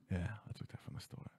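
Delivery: very soft, low admission; sheepish confession. Retold or imitated. Child admitting to mom he stole candy, sheepishly. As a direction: confess it almost under your breath.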